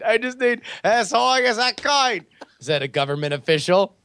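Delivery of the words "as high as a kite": higher, hammed-up Australian accent; mock Australian accent. Co-host echoing the official's quote, exaggerated mock Australian. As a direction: relish the quote in broad fake Australian. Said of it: "That's what I needed".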